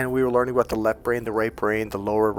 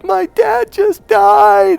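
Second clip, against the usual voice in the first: loud, very high drawn-out wail; hammed-up crying shriek; imitated bawling; 11 dB louder, much slower than usual. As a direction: act out a grief-stricken scream, stretching the words. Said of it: "And she's just bawling".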